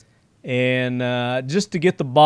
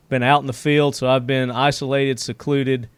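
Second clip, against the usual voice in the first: ordinary delivery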